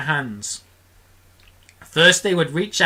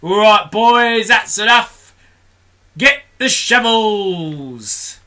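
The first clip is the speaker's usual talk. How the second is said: loud, higher, slow foreman's call; shouting; 7 semitones higher, 7 dB louder, slower than usual. Acted. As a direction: bellow orders across the cloud. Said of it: "they heard him shouting".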